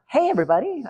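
put-on high swooping Valley girl greeting. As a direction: give a quick Valley girl impression. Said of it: "I want it to be a Valley girl".

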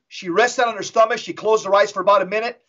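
usual speaking voice

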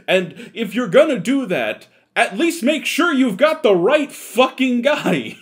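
high, loud indignant rant; exasperated outburst. Real, not acted. Mistaken man scolds commenters for yelling at the wrong guy, exasperated. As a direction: raise your voice in real exasperation.

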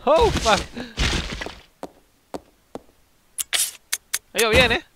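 loud, high yell, then bitter sarcasm; angry outburst. Real, not acted. Player cursing a failed move, loud angry yell. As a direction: blow up at the screen.

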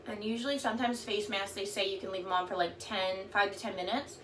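lips hemmed by wet sheet; through a sheet mask. Real, not acted. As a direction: face covered while talking. Seen 0: Wet sheet mask covers whole face while talking; R frames bare.

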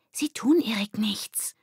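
high, slow, urgent hiss; hissed whisper. A narrator's reading. Verry pulling Lilly away from Ole, hissing. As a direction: hiss it into someone's ear. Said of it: "zischte Verry in Lillys Ohr".

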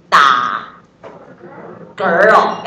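打嗝儿 said slowly, with the tongue curled back on 儿; curled-tongue erhua. Shown as a demonstration. Teacher demonstrates the curled-tongue 打嗝儿 to the class. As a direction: stretch out 打嗝儿 and roll the r sound at the end. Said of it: "要卷舌啊".

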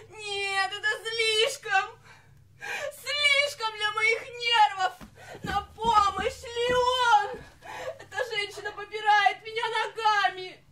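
high, tearful diva hysterics; hysterical outcry. Acted. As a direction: wail for rescue, overwhelmed and dramatic. Singer acted hysterics, calling for help.

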